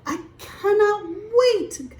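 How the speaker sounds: high, bright-eyed eager voice; excited. Acted. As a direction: bounce with excitement for the play date. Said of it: "Woo, he's excited".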